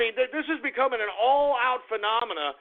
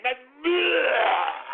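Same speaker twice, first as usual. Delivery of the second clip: disgusted; 3 semitones higher, much slower than usual. Real, not acted. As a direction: spit the word out as if nauseated. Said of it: "It's disgusting".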